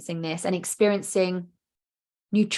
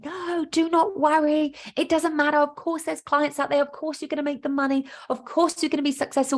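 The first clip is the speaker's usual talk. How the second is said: calm conscious mind; 7 semitones higher than usual. Pretend. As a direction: talk yourself down kindly, pitched up. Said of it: "your conscious part of your brain is going".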